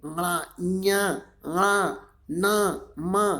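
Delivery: आड़ा, ञा, आ, ना, मा drawn out through the nose; nasal articulation. Shown as a demonstration. Teacher repeats the nose sounds for learners, slow nasal ञा, ना, मा. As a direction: hold ञा, ना, मा in the nose, slowly. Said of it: "नाक से बोले जा रहे हैं".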